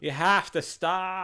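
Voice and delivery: slow, high "stop" with a long A; drawn-out stop. Acted. Playing a friend's spelling aloud, drawn-out "stop". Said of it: "how you write stop".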